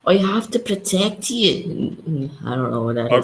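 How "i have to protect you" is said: higher, slow mocking Scottish accent; teasing Scottish accent imitation. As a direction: parrot the bad Scottish accent. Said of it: "What's that Scottish accent?".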